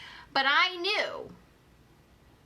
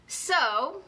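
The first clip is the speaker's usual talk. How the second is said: ordinary talk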